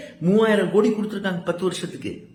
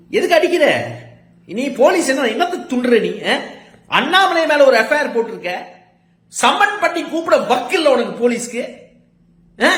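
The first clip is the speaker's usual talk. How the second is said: angry shout; 7 semitones higher, 4 dB louder than usual. Real, not acted. Shouting at police over beating.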